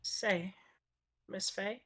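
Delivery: usual speaking voice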